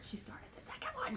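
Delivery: secret whispered aside. Real, not acted. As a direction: lean in and whisper it. Whispered aside about the sock.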